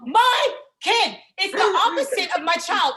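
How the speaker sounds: put-on dawning terror at the wrong kid; mock panic building; rising panic. Panic discovering wrong baby.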